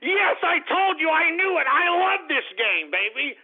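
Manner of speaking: ordinary talk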